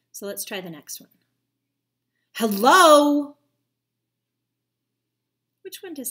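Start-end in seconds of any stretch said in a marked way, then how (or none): 2.3-3.4 very loud, higher angry shout of Hello; mad hello. Shown as a demonstration. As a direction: bark the greeting in anger. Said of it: "that seems mad".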